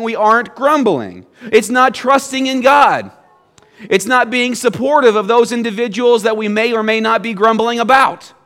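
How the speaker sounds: preaching at full force. Acted. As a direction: preach it, hammer every don't.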